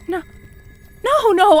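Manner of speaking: high, loud frantic denial; panicked backpedal. Acted. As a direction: scramble to take it back.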